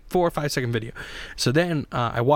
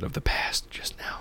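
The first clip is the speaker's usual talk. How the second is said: unvoiced whisper, murmured aside; 8 dB quieter than usual. Real, not acted. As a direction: whisper it like a confession. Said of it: "sorry about that".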